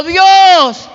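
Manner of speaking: loud, high proclamation from above; one ringing, stretched word. Retold or imitated. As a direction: shout your name down from on high. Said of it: "le dijo".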